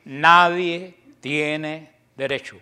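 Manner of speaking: each word drawn out and leaned on, nadie hit hardest; slow emphatic. Real, not acted. Preacher to his flock, insisting, slow and emphatic. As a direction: spell out nadie tiene derecho slowly, word by word.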